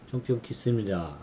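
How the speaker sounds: 점, 병, 캤습니다 rattled off very fast; rapid practice. Shown as a demonstration. Learner drilling a Korean greeting, very fast. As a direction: rush through 점, 병, 캤습니다 in one breath. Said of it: "making sure I say it right".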